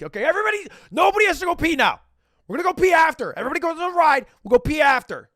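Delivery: high, loud stern announcement; dad shouting orders. Retold or imitated. Dad taking charge of the kids in the queue, loud and commanding. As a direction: bark it out to the whole group. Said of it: "the dad's like".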